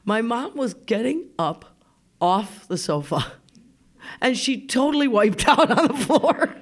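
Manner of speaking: usual speaking voice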